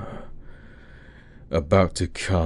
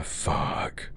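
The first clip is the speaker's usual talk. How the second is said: whispered curse; 8 dB quieter than usual. Acted. Intimate roleplay, muttered curse.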